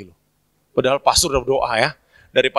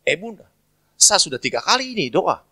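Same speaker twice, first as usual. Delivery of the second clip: raised-pitch Papuan mimicry; Papuan speech; 5 semitones higher than usual. Young Papuan man complaining to Mary, imitated accent. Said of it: "orang Papua cepet ngomongnya".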